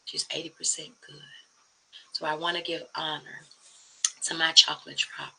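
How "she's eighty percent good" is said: soft whispered echo of the line; hushed whisper for emphasis; whispered repeat. Shown as a demonstration. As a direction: breathe it again as a quiet whisper.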